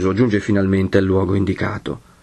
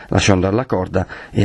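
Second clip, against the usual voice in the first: usual speaking voice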